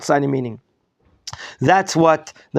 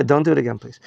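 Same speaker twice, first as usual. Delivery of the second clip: ordinary talk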